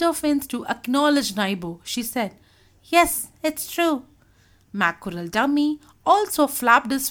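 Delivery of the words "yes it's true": high, slow agreement; conceding. A narrator's reading. Tuna acknowledging the old squid, high slow agreement. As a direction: draw out the admission.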